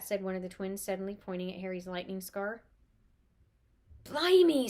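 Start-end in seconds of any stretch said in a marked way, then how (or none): 4.0-4.7 loud, high astonished blimey; amazed gasp. Acted. Twin stunned at meeting Harry, loud astonishment. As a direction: gasp it out in disbelief. Twins gawk at Harry Potter.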